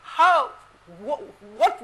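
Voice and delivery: ordinary delivery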